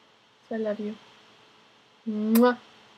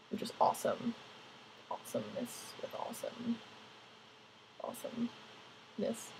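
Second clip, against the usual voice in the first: soft whispered aside; whispering; 16 dB quieter than usual. Real, not acted. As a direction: breathe it out in a whisper.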